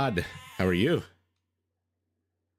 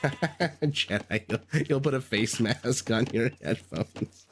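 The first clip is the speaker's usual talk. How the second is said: talking through real laughter; laughing; slower than usual. Real, not acted. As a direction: laugh while getting the words out, halting. Laughing at a chat joke.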